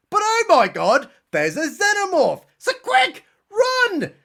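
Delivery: hammed-up shriek of fear; mock alarm. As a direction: yell a panicked warning. Calling alarm at xenomorph.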